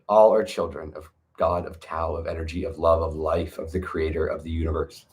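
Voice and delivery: soft reverent murmur about God. Real, not acted. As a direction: let it sink into a hushed blessing. Reverent aside on all beings.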